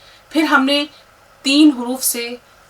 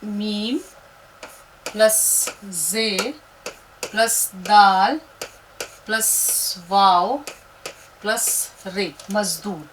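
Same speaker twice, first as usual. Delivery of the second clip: very slow, drawn-out letter listing; teacher adding letters to build a word; slow letter count; much slower than usual. Shown as a demonstration. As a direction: count the letters off one by one slowly. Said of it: "अब उसके बाद देखिए".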